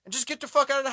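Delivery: high, loud yell of advice; yelling at screen. Retold or imitated. Viewer yelling at the hero to grab his wife, high and loud. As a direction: shout at the hero to grab her and run.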